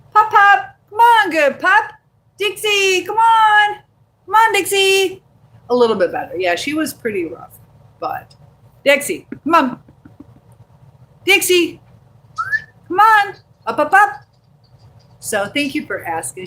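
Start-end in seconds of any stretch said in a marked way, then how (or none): 0.1-5.2 high, loud calling to a pet; much higher, louder dog-owner voice. Real, not acted. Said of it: "You have my dog voice". 8.8-14.2 high, loud summons to a pet; loud, raised-pitch pet-calling voice; dog voice. Real, not acted. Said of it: "You have my dog voice".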